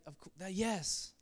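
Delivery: a hint of a gentle, compassionate Jesus; tender Jesus. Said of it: "But he's like".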